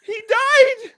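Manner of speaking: loud, very high squeal from laughing; real laughing fit squeezing the voice high; helpless laughter. Real, not acted. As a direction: blurt it while cracking up. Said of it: "I couldn't stop laughing".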